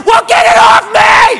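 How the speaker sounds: high, loud, stretched-out shriek; panicked scream. Retold or imitated. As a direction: act out frantic alarm at the top of your voice. Retold panic at thing on him.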